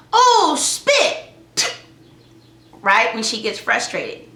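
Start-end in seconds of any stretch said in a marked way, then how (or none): 0.1-1.3 "Oh, spit." much higher, louder, rising "oh"; imitating daughter. Mom imitating her daughter's frustrated "Oh, spit." in a high voice. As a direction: play the little girl frustrated saying "Oh, spit.". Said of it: "So now Erica says".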